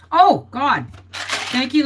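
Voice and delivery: normal talking voice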